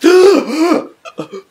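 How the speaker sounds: huge high gasp after surfacing; gasp for air. Acted. Man surfacing from the sea, huge gasp. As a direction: suck in air like you nearly drowned.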